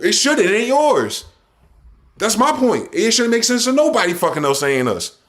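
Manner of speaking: heated rant. Real, not acted. Heated rant climbing in pitch.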